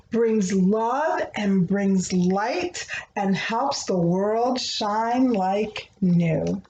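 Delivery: gentle, rhythmic picture-book reading; reading to children. Acted. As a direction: read the rhyming prayer like a bedtime story. Reads a rhyming prayer book aloud.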